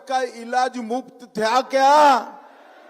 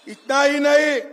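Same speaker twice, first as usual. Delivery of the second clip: normal talking voice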